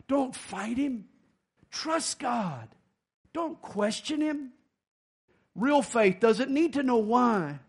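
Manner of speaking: usual speaking voice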